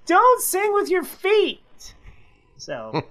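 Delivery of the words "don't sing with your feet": put-on much higher, slow, tearful little boy wailing "Don't sing with your feet"; crying toddler. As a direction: imitate a two-year-old in tears, stress on "Don't". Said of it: "Him in tears".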